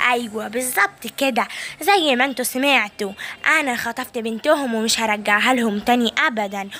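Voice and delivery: put-on low, wicked old woman boasting; gloating evil granny. Evil granny boasting to viewers about kidnapping, low and wicked. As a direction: taunt the viewers with relish.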